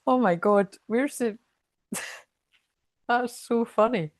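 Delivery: normal talking voice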